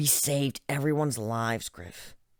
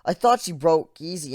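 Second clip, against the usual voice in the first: plain reading voice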